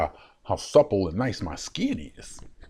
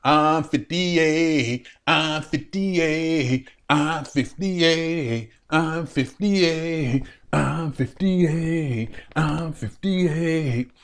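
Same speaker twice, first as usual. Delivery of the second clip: drawn-out, teasing repeated "fifty-eight"; slow repetition; 5 semitones higher, much slower than usual. Acted. Man insisting on his age to viewers, slow teasing repeat. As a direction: savor each "I'm fifty-eight" slowly.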